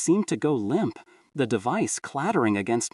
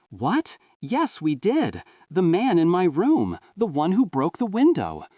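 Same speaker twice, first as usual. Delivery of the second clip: put-on higher and louder, a grown son pleading like a child; desperate insistence; 7 semitones higher, 4 dB louder than usual. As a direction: Leo insisting to his mother the break-in was real, desperate. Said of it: "The words rushed out, desperate".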